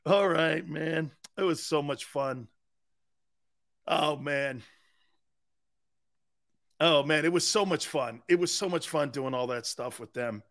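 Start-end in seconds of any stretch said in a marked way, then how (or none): none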